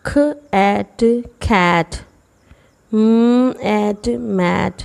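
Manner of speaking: slow, sound by sound ख एट खैट. Shown as a demonstration. Teacher blending sounds for students slowly. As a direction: build खैट and मैट slowly from their sounds. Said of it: "इसको आवाज़ों के ज़रिए बनाऊँ".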